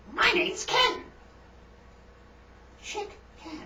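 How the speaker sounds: very high falsetto chicken parodying a spy; tiny chicken voice mimicking a suave introduction; chicken character. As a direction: squeak it with a dramatic pause before the surname.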